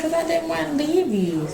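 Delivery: high child's voice, imitating her daughter. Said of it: "She said".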